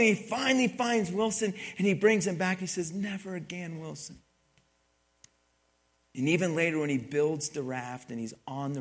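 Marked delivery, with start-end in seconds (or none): 2.9-4.2 hushed, soft promise trailing away; tender promise. Acted. Castaway bringing his volleyball back, soft hushed promise. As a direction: say it softly, as a private vow.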